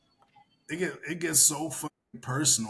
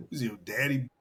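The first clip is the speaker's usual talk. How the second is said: usual speaking voice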